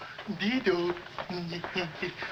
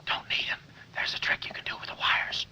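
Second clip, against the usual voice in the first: whispering; 6 dB quieter than usual. Acted. Hushed escape plan.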